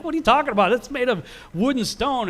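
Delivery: high, incredulous dad voice; father lightly voiced, baffled by his son; exasperated father. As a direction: scoff at the son's silly claim. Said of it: "And Terah's like".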